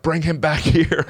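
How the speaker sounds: high mock scream, breaking into laughter; retold screaming. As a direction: imitate her screech without full volume.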